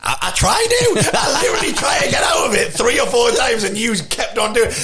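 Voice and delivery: high, laughing protest. Real, not acted. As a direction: laugh through the complaint. Laughing complaint about Rap God.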